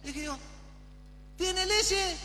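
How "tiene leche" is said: high, timbre-shifted innocent asking; cheeky question. Retold or imitated. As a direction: ask for milk with a wink. Asks patrona for milk.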